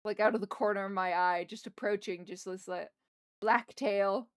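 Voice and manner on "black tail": ordinary talk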